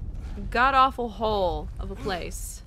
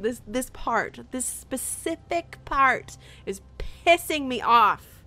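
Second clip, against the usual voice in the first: ordinary talk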